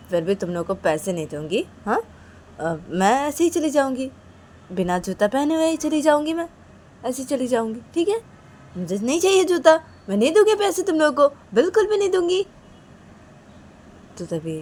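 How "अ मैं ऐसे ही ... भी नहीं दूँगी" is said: high-pitched defiant protest; stubborn refusal. Acted. Kajal would rather leave without her shoe, defiant. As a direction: dig in and refuse, voice climbing high.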